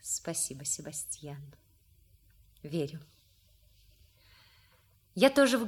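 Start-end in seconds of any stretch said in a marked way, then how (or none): 0.0-3.0 low, soft sincerity; moved, quiet thanks. Acted. Daughter reassured that he loved her father, moved quiet thanks. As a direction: thank him softly, voice sunk low.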